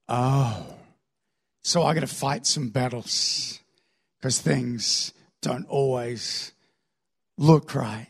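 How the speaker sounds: low, soft, halting resignation; sober realisation. Acted. Older believer realising battles must be fought, sober. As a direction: let it dawn slowly and quietly.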